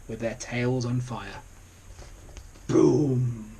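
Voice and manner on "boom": plain reading voice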